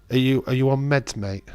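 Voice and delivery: ordinary talk, nothing marked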